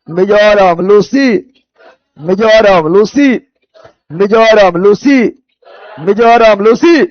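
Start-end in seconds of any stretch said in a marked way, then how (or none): none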